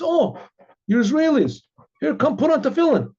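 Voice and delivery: high-pitched, eager welcome; excited invitation. Retold or imitated. Chabad boy to the Israeli family, excitedly inviting them. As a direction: pipe up delightedly and beckon them over.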